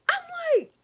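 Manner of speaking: squeaky high exasperated I'm like. Retold or imitated. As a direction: pitch it way up, fed up. Exasperated reaction.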